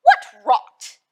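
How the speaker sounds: loud, high jeering shout; scornful yell of disbelief. A narrator's reading. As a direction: bellow it like a mocking monkey. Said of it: "The Wicksham brothers came shouting".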